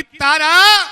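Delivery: furious king shouting for his wife; angry loud shout. Acted. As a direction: shout the wife's name in anger. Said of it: "बड़ी जोर से चिल्लाया".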